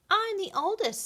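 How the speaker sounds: high, proud claim; big sister kitten grabbing for the egg, bossy. A narrator's reading. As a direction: insist you come first. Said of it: "Spotty said".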